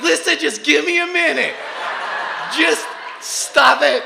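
high, loud mock-crying voice; comic bawling dad begging for a minute; hammed-up tearful plea. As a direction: plead through pretend sobs. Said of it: "I'm weeping uncontrollably".

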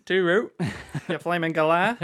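laughing mock Aussie; broad Australian with a laugh breaking in; Australian accent. Friends trading Aussie insults for fun, mock Australian accent. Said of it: "our Australian accents for us".

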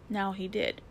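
ordinary delivery

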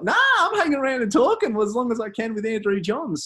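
high, loud defiant mock refusal; stubborn Therese. Therese refusing to leave Andrew Johns, high defiant mimic. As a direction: dig your heels in cheerfully.

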